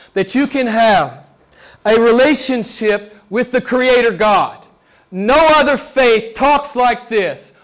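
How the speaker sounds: measured, hammering pulpit delivery; emphatic preaching. Real, not acted. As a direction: proclaim it with sermon force. Preacher proclaims uniqueness of faith.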